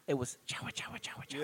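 whispered chawa. Shown as a demonstration. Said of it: "the sound wasn't even like ch".

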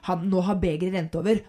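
ordinary talk, nothing marked

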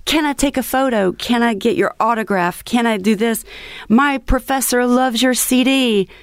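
high, eager fan voice. Retold or imitated. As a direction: mimic pushy college kids. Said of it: "they're like".

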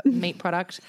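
ordinary delivery

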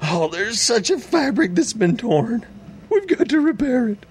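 high, sneering whiny parody; mock him in a high whine. Host mocks the legislator's speech.

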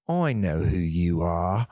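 put-on lightly done British accent, low and slow. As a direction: drawl it out like a British manager. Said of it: "I won't do the accent for this next part".

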